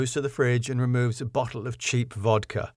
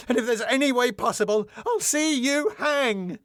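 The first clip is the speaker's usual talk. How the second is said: high-pitched, mounting indignation; scandalised tirade, climbing in pitch; outraged threat; 10 semitones higher than usual. Acted. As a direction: threaten furiously, building higher to the end. Irons threatens Max furiously.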